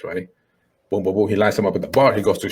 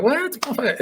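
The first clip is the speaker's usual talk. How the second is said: much higher squeak of shock; shocked what. Retold or imitated. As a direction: gasp out what in disbelief. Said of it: "She's like".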